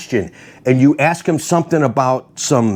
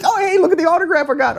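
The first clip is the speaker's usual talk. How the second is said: high-pitched, rushed mimicry through laughter; laughing imitation; faster than usual. Retelling fans showing off their autographs, laughing high-pitched mimicry. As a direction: mimic a show-off fan, laughing.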